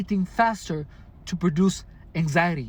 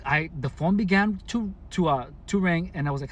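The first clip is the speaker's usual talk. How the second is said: normal talking voice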